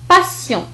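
"باسيون" with s, then a nasal on; S sound. Shown as a demonstration. Teacher giving another example, s in "باسيون". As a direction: say "باسيون" as pa-syon, voiceless s. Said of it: "فنطقت كحرف السين".

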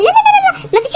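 shrill-high no no no no, squeaking it out; high squealed refusal. Acted. Girlfriend refusing her fiancé in a high squeal.

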